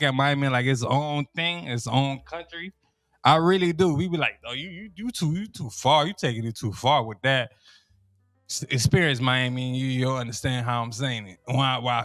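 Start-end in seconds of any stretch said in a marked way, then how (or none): none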